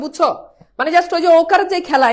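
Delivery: usual speaking voice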